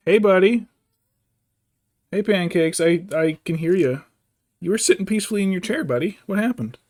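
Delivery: loud, high talk to a fussing cat; calling to pet. Real, not acted. Owner asking his cat what happened, loud and high. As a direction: raise pitch and volume to answer the cat.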